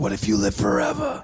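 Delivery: low, knowing hint of mystery; mock wise villager. Villager answering the frightened finder, low and sly. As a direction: drop low like a sly sage.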